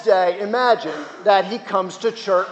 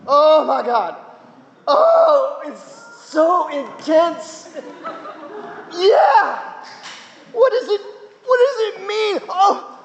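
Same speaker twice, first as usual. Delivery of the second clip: ecstatic awe; 9 semitones higher, slower than usual. Retold or imitated. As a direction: squeal it like a man losing it over the sky. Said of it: "he says".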